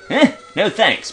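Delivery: usual speaking voice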